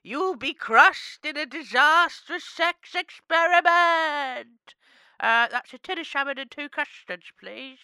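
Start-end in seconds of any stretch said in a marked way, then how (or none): 0.0-4.8 dramatic prophecy. Pretend. Fortune teller's prophecy.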